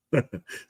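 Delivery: short chuckle escaping; quick, quiet laugh at a private joke; small suppressed chuckle; real laugh. Real, not acted. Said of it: "sorry, I'm laughing at the comment".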